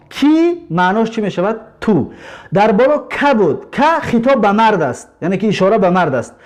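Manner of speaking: normal talking voice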